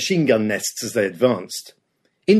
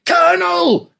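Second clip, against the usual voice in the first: very high, louder furious shout; screamed back; 4 dB louder, slower than usual. Acted. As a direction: roar the rank right back at him. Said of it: "Canham screamed back".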